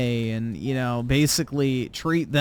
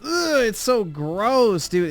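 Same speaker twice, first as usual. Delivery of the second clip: disgusted; 7 semitones higher, 4 dB louder, slower than usual. Real, not acted. Disgusted rant about weebs.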